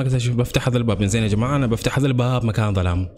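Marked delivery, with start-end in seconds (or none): none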